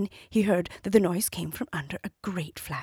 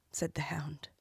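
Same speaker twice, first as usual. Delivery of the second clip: usual speaking voice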